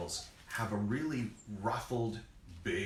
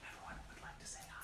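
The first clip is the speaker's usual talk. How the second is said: soft unvoiced whisper; 17 dB quieter, much faster than usual. Real, not acted. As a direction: whisper it off mic. Whispering aside to co-host.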